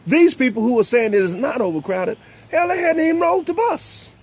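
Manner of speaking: high, heated sermon-style delivery; fired-up preaching. Real, not acted. Talk show host preaching to viewers, fired up. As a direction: preach it with rising fire.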